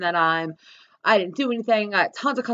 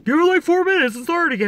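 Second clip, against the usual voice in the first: higher, put-on know-it-all voice; mock douchebag; 7 semitones higher, 4 dB louder than usual. Douchebag advising a stranded driver, mocking high voice. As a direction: imitate an unhelpful bystander up high. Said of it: "some douchebags over here, like".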